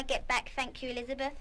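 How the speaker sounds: low, faint and withdrawn; subdued thanks. Acted. Shocked girl thanks Elizabeth on leaving, faint and subdued. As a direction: barely get the words out, shaken. Joan shaken, leaving alone.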